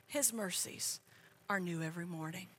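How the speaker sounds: low, quick and soft, almost thrown away; quick soft aside. Acted. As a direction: rattle it off under the breath. Second take of the verse.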